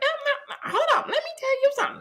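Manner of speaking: very high squeaky mock scolding; cartoonishly high nagging squeal; barking mom. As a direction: imitate mom cutting in and rattling on. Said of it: "she's barking at it".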